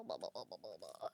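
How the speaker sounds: deep falling mock blahs; low mock blah. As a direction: drop low and drone blah blah. Mimicking frames coming apart.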